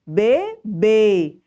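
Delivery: Bebê said loud, closed bê; model word. Shown as a demonstration. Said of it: "Onde é o acento?".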